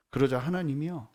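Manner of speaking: usual speaking voice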